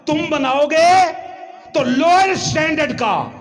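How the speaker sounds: loud, high preaching cry; forceful rebuke. Acted. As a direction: proclaim it at full force to the crowd. Preacher voices a divine rebuke.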